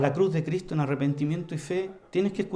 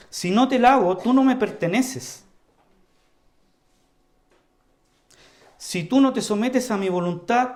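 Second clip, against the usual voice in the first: louder, higher, firm warning; forceful answer; 3 semitones higher, 4 dB louder than usual. Retold or imitated. Preacher voicing Jesus' stern warning to Peter, forceful. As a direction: press the warning home hard. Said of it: "es muy fuerte la respuesta de Jesús".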